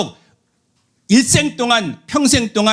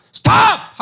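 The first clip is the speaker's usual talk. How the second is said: 명령하는 외침; 13 semitones higher, much slower than usual. Retold or imitated. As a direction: bark the order. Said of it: "명령해야 될 줄로 믿습니다". (자녀를 멈추게 하는 큰 명령)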